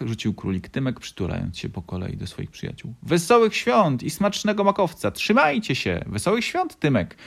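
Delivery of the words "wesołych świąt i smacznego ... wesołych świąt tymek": high, loud little-fox shout; calling back. A narrator's reading. Little fox calling back to his rabbit friend, high happy shout. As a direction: call out cheerily, pitched way up. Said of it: "odkrzyknął mały Lisek".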